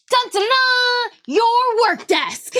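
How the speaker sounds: high, slow anime fanfare; bright magical girl. Pretend. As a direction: sing out the grand reveal with a laugh. Acting out the magical desk reveal.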